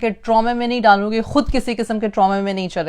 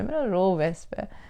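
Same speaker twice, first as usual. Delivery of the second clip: softer, slower "मेरा रोब है इस पे" with "मेरा" stressed; quiet repeat; 3 semitones lower, 9 dB quieter than usual. Shown as a demonstration. To parents, repeating the bully's boast, softly. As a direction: lets the smug words sink in.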